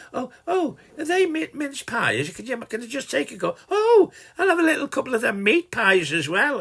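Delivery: high-pitched, mock-innocent cadging; mimicked scrounging visitor. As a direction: put on a high voice, angling for free food. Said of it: "I'll bet you'll say".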